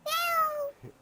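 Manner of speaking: cat meow. Shown as a demonstration. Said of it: "how does a kitty go?".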